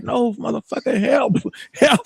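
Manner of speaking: high, desperate pleading for help with a laugh breaking in; mock cry for help. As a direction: beg for help, half laughing. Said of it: "I'm like".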